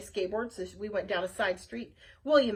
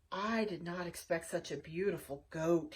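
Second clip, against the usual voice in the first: low goat voice; 5 semitones lower, 5 dB quieter than usual. Acted. Goat William voiced in story.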